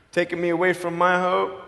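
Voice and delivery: ordinary talk, nothing marked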